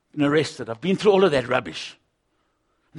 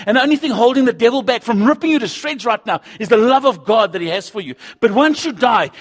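loud, high, urgent preaching; fiery preacher warning listeners about death and hell; preaching at full force; 10 semitones higher, 11 dB louder than usual. Acted. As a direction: hammer the warning home to the crowd. Warns the unsaved, preaching.

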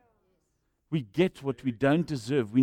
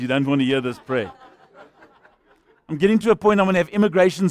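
laughing joke; 5 dB louder than usual. Real, not acted. Joking, laughter.

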